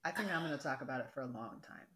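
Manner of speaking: quick low teasing aside to the co-host; mutter aside. Real, not acted. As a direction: mutter it under your breath. Joking aside under breath.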